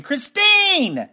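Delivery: high, loud drawn-out scream for help; desperate scream. Acted. Tied-up man screams to Christine, desperate high yell. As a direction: scream the name out with all you have. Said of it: "and I scream".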